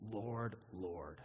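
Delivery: low, soft and unhurried invocation; humble plea. Shown as a demonstration. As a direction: say it low and softly, as a humble address. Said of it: "That is what we say on that day".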